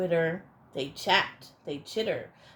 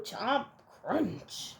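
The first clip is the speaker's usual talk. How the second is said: ordinary delivery, nothing marked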